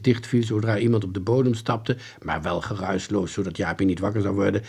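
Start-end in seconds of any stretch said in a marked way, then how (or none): none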